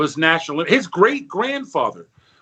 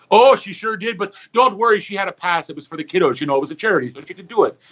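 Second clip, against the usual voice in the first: Irish bartender; 3 semitones higher, faster than usual. Acted. Bartender defends nun.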